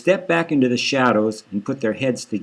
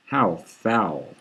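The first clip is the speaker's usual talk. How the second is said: disgusted; 4 semitones lower, 4 dB quieter than usual. Acted. Inner thought, disgusted by breath.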